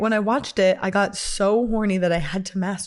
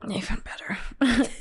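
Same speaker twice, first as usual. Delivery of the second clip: breathy conspiratorial whisper; 4 dB quieter, slower than usual. Real, not acted. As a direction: whisper it like a secret. Teasing hushed reveal.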